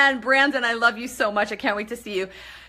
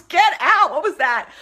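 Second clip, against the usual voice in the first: delighted disbelief; 6 semitones higher, 7 dB louder than usual. Real, not acted. Reacting to a flood of hearts.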